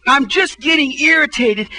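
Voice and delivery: ordinary talk, nothing marked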